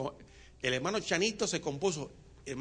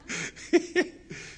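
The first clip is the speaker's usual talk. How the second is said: laugh; 13 semitones higher than usual. Real, not acted. Preacher laughs.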